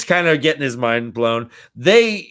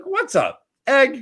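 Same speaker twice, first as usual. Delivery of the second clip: mock Rand; 10 semitones higher than usual. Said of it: "he's like".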